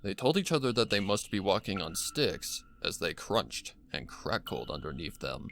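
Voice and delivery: plain reading voice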